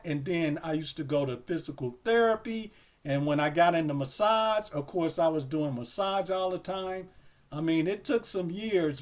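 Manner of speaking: ordinary delivery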